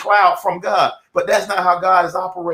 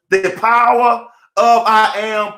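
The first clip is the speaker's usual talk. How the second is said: slow, louder announcement of the title; 3 semitones higher, 4 dB louder, slower than usual. Acted. To his live audience, proclaiming the lesson's title slowly.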